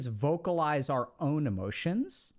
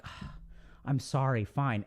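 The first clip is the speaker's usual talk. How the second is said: resigned sigh, then a low apology; caving in; 4 semitones lower, slower than usual. Acted. Worn-down partner caving to a crying one, resigned apology. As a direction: give in with a sigh, dropping low. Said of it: "then you'll cave".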